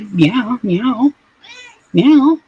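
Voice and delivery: high, loud mock meowing; squeaky kitten imitation; loud high meows. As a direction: meow back at the cat, big and high. Meowing at cat.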